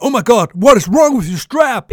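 high, loud, slow mock alarm; imitating a horrified instructor. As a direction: play the tandem master spotting a broken strap. Quoting the alarmed tandem master.

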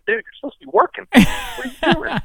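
mock scolding.

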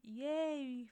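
half-hearted, drawn-out mock cheer; mock enthusiasm. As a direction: fake a cheer with no real interest. Mock cheer at sports talk.

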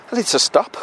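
winded, breaking off to puff; out of breath. Real, not acted. Walking podcaster tells listeners they must stop, out of breath. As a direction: gasp it out, needing a rest. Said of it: "I'm really out of breath".

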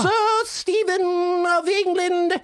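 high, slow British royal proclamation; solemn regal decree, drawn out; Queen of England. Pretend. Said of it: "Great accent".

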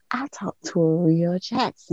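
re-enacted hushed whisper of disbelief; breath-only, starstruck murmur to oneself; awestruck whisper. Retold awestruck moment after the call.

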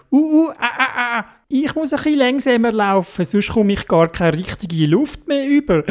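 high, puffing voice, out of breath from running; panting Dodo. Acted. Dodo panting to Theobald while running uphill. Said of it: "der Dodo gesagt".

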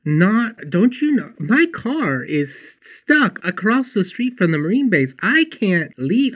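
exasperated, high-pitched inner rant; replaying my impatience out loud; frustrated. Waiting in the hallway for a ride, exasperated. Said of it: "I am starting to get a little bit frustrated".

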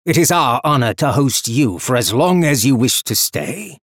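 loud, hearty welcome; booming. A narrator's reading. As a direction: boom it out, warm and grand. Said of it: "boomed out".

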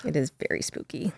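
soft unvoiced agreement; whispered reply joining the spooky mood. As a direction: play along in a hushed whisper. Answers in matching whisper.

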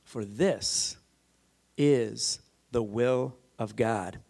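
slow, low, with a pause after each phrase; deliberate emphasis. Real, not acted. Preacher insisting there is no ambiguity, slow and deliberate. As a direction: spell it out with total certainty.

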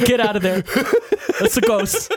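giggling fake terror at a ghost on screen; laughing ghost panic. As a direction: act out a spooked moviegoer while cracking up. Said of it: "he goes like".

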